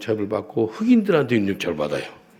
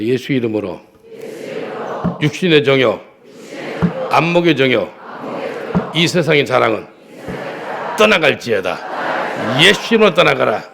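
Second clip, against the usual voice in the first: commanding preaching; 4 semitones higher, 8 dB louder than usual. Acted. Preacher rebuking worldly lusts before the congregation, loud commanding preaching. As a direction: rebuke the lusts in Jesus' name at full force.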